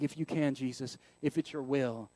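low, soft, resigned asking; acted glum, hedged request; downplayed prayer. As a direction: pray it small, expecting little. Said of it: "so I downplay my prayers".

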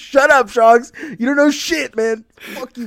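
high, loud play-angry yell; mock outraged yell. Friend scolds Shogs for teasing, mock yelling. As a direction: fake fury at his buddy, yelled.

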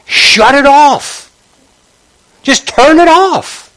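drawn-out, high-pitched exhortation; emphatic command. Real, not acted. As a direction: urge them, stretching every word high. Preacher urging listeners to switch off.

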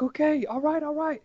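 high, eager imitated okay; bubbly volunteer confirming; excited. Flashlight lady reacting to the runner, high excited voice. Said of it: "She was so excited".

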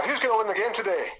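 high-pitched Scottish impression; broad Scottish accent. Captain repeating his question to the boy, broad Scottish accent. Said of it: "quite a broad Scottish accent".

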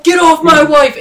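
high, loud furious yell; jealous shout. Retold or imitated. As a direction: roar it at the man touching his wife. Jealous ghost shouting at rival.

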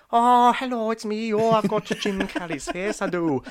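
high, loud mock Irish lilt drifting Scottish; Scottish-leaning Irish voice. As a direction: ham up a little Irish ghost greeting, high and loud. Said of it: "That went Scottish".

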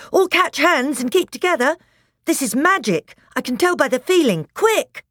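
high, loud, urgent orders; rally everyone in a hurry; urgent shout. Acted. Edmund telling everyone to catch hands, urgent shout. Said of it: "shouted Edmund".

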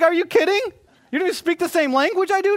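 high, loud, exasperated disbelief; laughing indignant rant; incredulous outrage. Retold or imitated. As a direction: blow up at the phone agent. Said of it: "I was upset".

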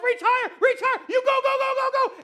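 high, loud, frantic cheering; reenacted sideline parent yelling; freaking out. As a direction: scream them up the rope. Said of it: "I started freaking out".

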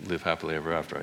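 ordinary talk

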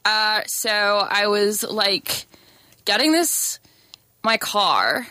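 American storytelling, slow start; hesitant American anecdote; American accent. Shown as a demonstration. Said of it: "Now do an American accent".

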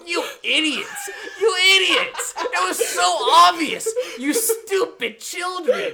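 high, loud, laughing mock rant at children; mock furious yell. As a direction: yell at the dumb kids through laughter, hamming it up. Mock yelling at kids in theater.

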